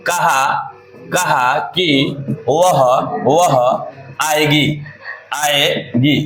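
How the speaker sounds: drawn out slowly, word by word; slow demo. Shown as a demonstration. Teacher slowly spelling out the sentence for students. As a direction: stretch each word out so students hear it. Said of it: "ध्यान समझिएगा".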